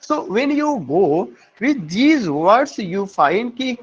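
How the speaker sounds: ordinary talk, nothing marked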